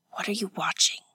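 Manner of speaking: soft, breath-only question; whisper. A narrator's reading. Woman behind her companion at the window, whispered. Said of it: "Hatsumi whispered".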